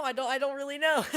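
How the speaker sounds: high, quick laughing admission; giggly and baffled; amused confusion. Real, not acted. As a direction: laugh it off, loud and hurried. Laughs at own confusion while reading.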